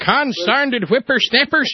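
put-on high, slow cranky old geezer voice; crazy old coot. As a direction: grumble like a crotchety grandpa. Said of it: "makes me sound like my father".

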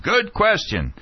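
high, mild mock shout; retold crowd shout. Audience cheering a student's question, mock shouting. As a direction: call out like an approving audience. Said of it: "shouts of".

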